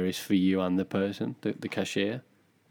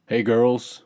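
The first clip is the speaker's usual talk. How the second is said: louder put-on American greeting; hammed-up American accent, calling out; 3 semitones higher, 5 dB louder than usual. Bouncer greeting the girls, American accent. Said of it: "how do you do American accent?".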